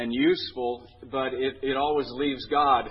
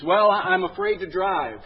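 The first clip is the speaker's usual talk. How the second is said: higher, louder voiced comedy patient; confessing patient; 6 semitones higher, 8 dB louder than usual. Patient to psychiatrist about driving, higher voice.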